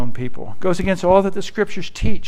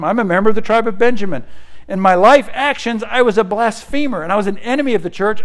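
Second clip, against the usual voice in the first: higher, louder, fast excited testimony; Paul speaking; 5 semitones higher, 5 dB louder, faster than usual. Acted. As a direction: rattle off Paul's credentials with rising fervour. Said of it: "He basically says".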